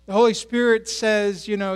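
ordinary talk

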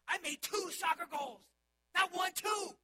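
high, loud triumphant shout; child boasting to the pastor, thrilled shout; proud shout. Retold or imitated. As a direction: crow it out like a proud kid. Kid proudly reports goals.

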